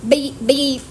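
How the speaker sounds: high, loud mock bleep sound; censor beep. Real, not acted. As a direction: bleep over the swear word. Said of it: "I'm just gonna blooper it".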